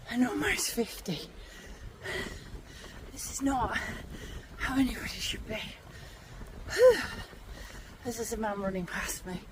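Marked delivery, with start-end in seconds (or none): 3.1-9.4 soft breathless whisper; hushed words under the breath; whispered aside. Real, not acted. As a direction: drop to a whisper mid-climb. Aside while climbing, unvoiced.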